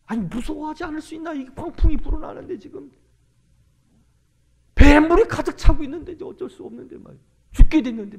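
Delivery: high-pitched protest building to a loud cry; panicked disciples. Acted. Disciples answering Jesus amid the flood, high loud panic. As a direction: protest in alarm, shooting up high. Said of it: "그렇게 제자들이".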